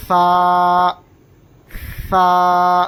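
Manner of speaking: long فا, air flowing out over the tongue tip on the upper teeth; air pushed outward. Shown as a demonstration. Teacher shows how air leaves the mouth, drawn-out airy فا. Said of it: "اندر کی side سے باہر کی side ہوا نکلے گی".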